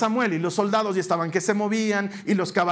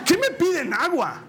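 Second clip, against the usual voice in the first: very high, slow, fed-up outburst; mock exasperated cry; exasperated Moses; 9 semitones higher than usual. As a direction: let the frustration burst out high.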